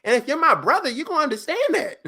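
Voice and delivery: high, loud, fast; excited as the idea grows; excited rant. Real, not acted. Friend says he'd take the yacht, excitedly. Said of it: "excited rant".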